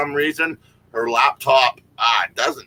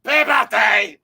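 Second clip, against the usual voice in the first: mock Scottish accent; 7 semitones higher, slower than usual. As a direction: bellow the song in a bad Scottish brogue. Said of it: "Can you do it in a Scottish accent?".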